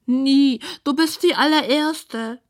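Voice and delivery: high, slow, mournful pig voice; downcast pig. A narrator's reading. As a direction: drag it out as a lonely pig. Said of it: "Das Schwein lässt den Kopf hängen".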